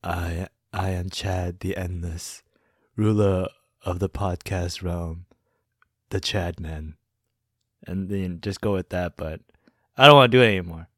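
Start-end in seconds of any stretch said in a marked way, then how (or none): 0.0-6.9 low, soft, slow grand dream ruler; dreamy distant voice. Shown as a demonstration. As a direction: intone it like an endless dream lord. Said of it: "I'll just say".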